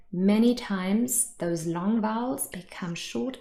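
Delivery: ordinary delivery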